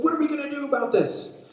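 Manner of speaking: high-pitched acted panic; frantic, high-pitched wail; panicking disciples. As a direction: cry out as if the boat is sinking. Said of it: "they're panicking and saying".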